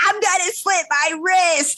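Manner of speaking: high, slow, hammed-up teen threat; exaggerated sulky announcement, slow and high; mock melodramatic threat. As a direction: overplay the moody teenager. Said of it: "ignore the fact that I'm like".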